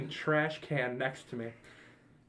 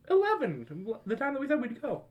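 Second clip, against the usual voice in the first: mom's voice; 9 semitones higher than usual. Retold or imitated. Mom waking her hungover son by phone, high and sweet.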